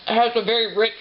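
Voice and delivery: talking through a mouthful; words round a bite of bread; mouth full. Real, not acted. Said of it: "I'll talk with my mouth full".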